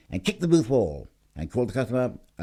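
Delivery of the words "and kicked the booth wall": ordinary delivery, nothing marked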